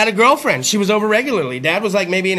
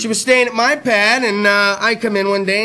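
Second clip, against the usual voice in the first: normal talking voice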